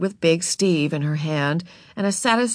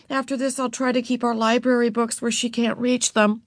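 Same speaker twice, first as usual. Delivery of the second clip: put-on apologetic girl; 6 semitones higher than usual. A narrator's reading.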